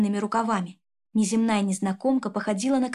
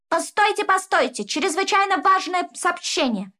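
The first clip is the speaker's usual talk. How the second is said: much higher, louder calling out; urgent call; 8 semitones higher, 4 dB louder than usual. Acted. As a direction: stop them all with an urgent cry. Dwarf rushes in calling out. (взволнованный возглас)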